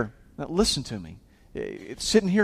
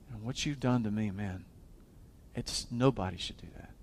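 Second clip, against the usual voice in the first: quiet disappointment; 9 semitones lower, 16 dB quieter than usual. Retold or imitated. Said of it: "That they'd say".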